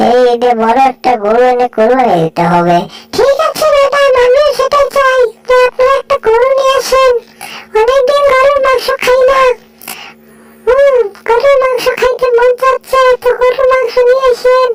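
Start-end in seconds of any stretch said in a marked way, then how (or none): 3.2-14.7 a higher, bright-eyed servant voice; higher cartoon servant voice; eager servant. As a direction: play the keen helper agreeing with madam. High-voiced servant answers madam.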